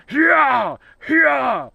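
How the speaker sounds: loud shouted yells, pitched high; scaring off bear. Retold or imitated. He bangs a stick on a tree to scare the bear off, shouting. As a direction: yell hard to chase the bear out of camp.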